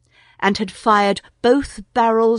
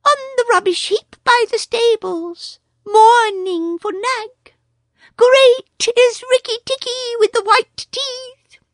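much higher chirpy bird voice; a small proud bird boasting; much higher excited bird telling the news; Darzee the tailorbird; 6 dB louder than usual. A narrator's reading.

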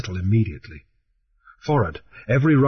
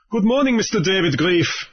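raised, mocking pirate greeting; Raoul calling up; 8 semitones higher than usual. A narrator's reading. Pirate Raoul greets Grief from below, calling up. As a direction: call up to the ship with a sneer. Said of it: "Raoul called up".

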